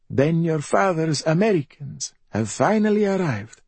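high, self-pitying bedridden mother; high-pitched raspy invalid voice; rasping mother. A narrator's reading. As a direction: rasp it high, a mother playing at dying. Bedridden mother speaking to her son.

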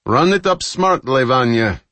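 high, loud order to a crewman; commanding. A narrator's reading. Captain orders Vanya to raise speed, commanding.

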